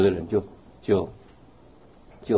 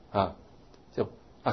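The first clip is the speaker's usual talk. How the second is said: ordinary delivery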